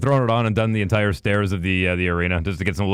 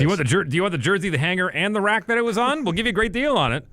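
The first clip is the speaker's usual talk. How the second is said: higher, faster hard-sell patter; mock pushy salesman; 7 semitones higher than usual. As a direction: ham up an eager clearance-sale clerk.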